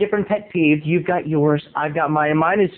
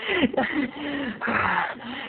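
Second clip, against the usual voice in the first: breathless panting sigh; 5 semitones higher, 9 dB quieter than usual. Retold or imitated. Laura panting.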